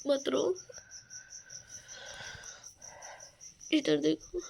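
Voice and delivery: soft, tender pleading; soothing. Real, not acted. Wife softly asking her husband to smile, tender. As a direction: gently beg him to smile.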